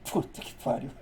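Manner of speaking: under his breath; a whispered Portuguese swear; whispered curse. Retold or imitated. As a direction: whisper it so only you hear. Reacting to the teacher's challenge.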